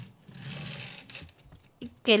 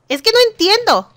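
baffled outburst; 9 semitones higher, 7 dB louder than usual. Real, not acted. Confused at Sims party.

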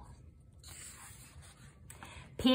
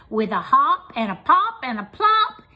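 ordinary delivery

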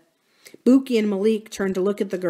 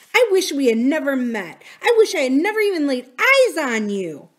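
high, louder angry regret; bitter outburst; 7 semitones higher, 5 dB louder than usual. Acted. Drew renounces her friend Corinne before running off, bitter. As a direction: hurl it as a parting blow.

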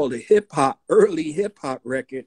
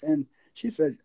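usual speaking voice

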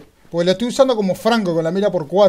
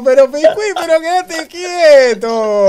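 laughing plea; 11 semitones higher, 9 dB louder, slower than usual. Real, not acted.

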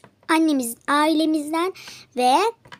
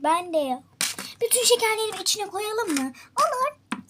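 normal talking voice

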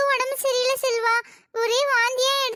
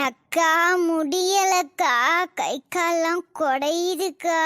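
weak sick voice; 6 semitones lower, much slower than usual. Acted. Sick Uma complaining weakly.

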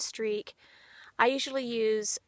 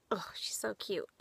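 softly whispered gushing; 7 dB quieter than usual. Real, not acted. Owner whispering her love for the bunny. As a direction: breathe it out in a whisper. Gushing quietly over rabbit.

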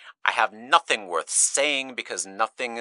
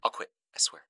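voiceless, softer vow; whispered promise; 5 semitones lower, 6 dB quieter, much faster than usual. Acted. Speaker quietly swearing to quit, whispered. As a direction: drop to a whisper.

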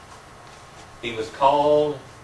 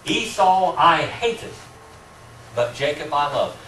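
slow, weighty reading, every word set apart; solemn scripture quote; 5 semitones higher, 4 dB louder, slower than usual. Shown as a demonstration. Teacher quoting God's words to the class, slow and emphatic. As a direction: slowly lean on "Esau" and "Jacob". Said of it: "God says".

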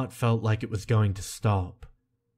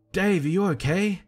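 shouted; 10 semitones higher than usual. A narrator's reading. Narrator as the friend checking on fallen Dave, high worried shout. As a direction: call down anxiously in a much higher voice. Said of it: "I shouted in between coughs".

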